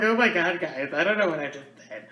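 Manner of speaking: hammed-up sob falling away; mock boohoo crying. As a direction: imitate yourself blubbering to viewers. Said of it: "like, boohoo crying".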